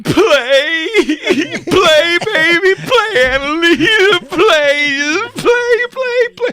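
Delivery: high, loud desperate screaming; screaming crying dad. Retold or imitated. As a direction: scream at your daughter on stage, sobbing. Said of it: "he starts going".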